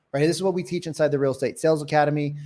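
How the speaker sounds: ordinary talk, nothing marked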